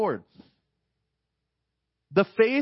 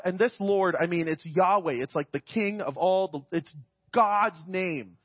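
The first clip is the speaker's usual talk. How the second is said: normal talking voice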